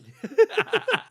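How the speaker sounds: sudden high laugh; high burst of laughter. Real, not acted. Laughs at own joke.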